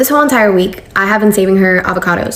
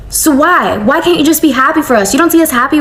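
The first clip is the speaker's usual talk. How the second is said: higher pleading frustration; retold exasperation; 6 semitones higher than usual. Pleading with the mother-in-law to be happy, exasperated and higher. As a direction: demand answers, exasperated and higher.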